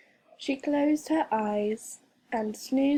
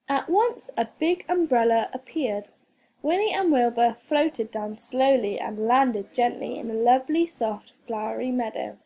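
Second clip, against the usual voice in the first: ordinary delivery, nothing marked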